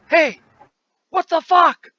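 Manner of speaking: startled henchman's shout, high and loud; screamed challenge. Acted. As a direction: yell it as if spotting an intruder. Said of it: "and he screams".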